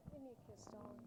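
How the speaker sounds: soft, sultry, flirty; breathy come-on to a guest; femme fatale. Acted. Host's flirty sign-off kiss.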